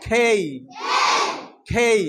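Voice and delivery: খেই with a strong breathy kh; aspirated khei. Shown as a demonstration. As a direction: push air on the kh of খেই. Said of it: "আমি কিন্তু জে বলি নাই".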